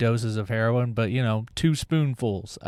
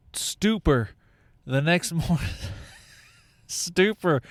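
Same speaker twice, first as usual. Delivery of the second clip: ordinary talk, nothing marked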